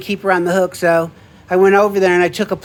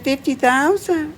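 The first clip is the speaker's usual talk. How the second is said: surprised repeat; 4 semitones higher, 4 dB quieter, slower than usual. Retold or imitated. Reacting to the price.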